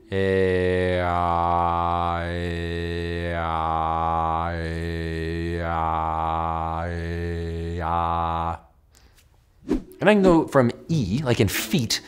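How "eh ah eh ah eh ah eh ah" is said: Eh and ah alternated as long low held tones; vowel glide demo. Shown as a demonstration. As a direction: slide between eh and ah by mouth shape. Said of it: "Check it out".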